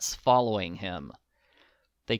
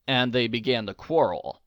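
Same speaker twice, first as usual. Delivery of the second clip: ordinary delivery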